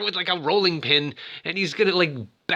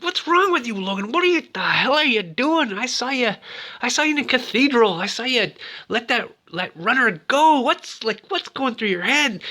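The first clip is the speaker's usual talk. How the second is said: accusing Francis; 9 semitones higher than usual. Retold or imitated. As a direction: call him out like a betrayed partner. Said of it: "He's like".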